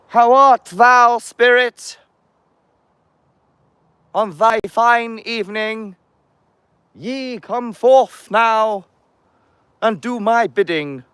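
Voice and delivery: very high, loud, slow archaic summoner voice; old-timey summoner. Pretend. Vlogger summoning spirits in a slow high archaic voice. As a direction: intone it like an eighteenth-century conjurer calling a spirit. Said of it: "What would they have spoke like in the seventeen hundreds".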